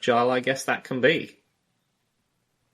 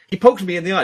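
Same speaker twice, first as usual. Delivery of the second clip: higher, louder whining complaint to the ref; whiny complainer; 8 semitones higher, 8 dB louder, faster than usual. Retold or imitated. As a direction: whine like a fighter tattling on his opponent. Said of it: "if they're like".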